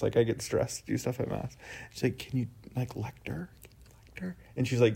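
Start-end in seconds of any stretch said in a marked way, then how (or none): 2.2-4.4 near-silent, mouthing the words across a room; barely voiced, mouthed question; mouthed request. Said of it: "motioning this to me across the".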